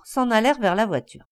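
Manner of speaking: ordinary delivery, nothing marked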